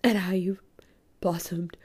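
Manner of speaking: whispered, awed compliment; hushed quote. Retold or imitated. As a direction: whisper it like a confidence. Quoting a mentor's praise.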